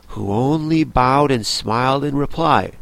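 plain reading voice

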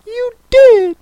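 high, slow, weepy turtle voice; very high tearful creature voice; Mock Turtle voice. A narrator's reading.

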